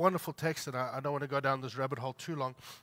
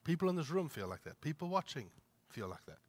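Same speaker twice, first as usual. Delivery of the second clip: ordinary talk, nothing marked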